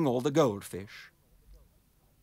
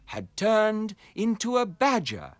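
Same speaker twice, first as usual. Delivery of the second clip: plain reading voice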